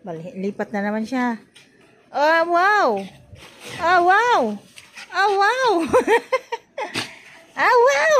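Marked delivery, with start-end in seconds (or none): 2.1-8.2 loud, climbing to a high squeal; delighted cheering. Real, not acted. Vlogger marvelling at the pet's steps, rising excited squeal. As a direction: let each wow jump higher, breaking into laughter.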